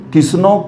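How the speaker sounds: कृष्णो as "kisno", r dropped; variant pronunciation. Acted. Pandit asking which is right, offering "kisno". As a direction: end कृष्णो on a round o, no r. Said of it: "क्या सही है".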